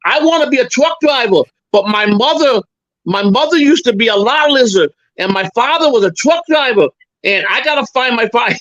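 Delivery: high, eager fake caller voice; a high put-on voice for a prank call. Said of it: "I said".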